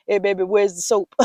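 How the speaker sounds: a husband calling out from another room; lightly voiced husband asking for soap; loud mock husband holler. Voicing husband interrupting.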